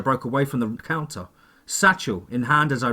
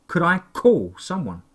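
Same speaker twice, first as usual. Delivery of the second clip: usual speaking voice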